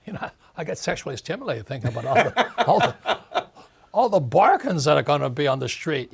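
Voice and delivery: laughing through the words; gloating to the host about crash bargains, laughing. Real, not acted. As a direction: chuckle while gloating over bargains.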